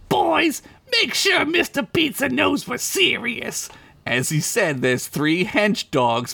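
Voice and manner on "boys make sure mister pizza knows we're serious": high squeaky gangster voice; menacing Crinkles. Acted. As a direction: lean in like a tiny crime boss. Crinkles orders henchmen.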